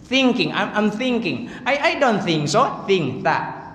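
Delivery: thinking with a careful Arabic tha at the start. Shown as a demonstration. Teacher drills think with the Arabic letter, careful th. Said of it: "huruf ta".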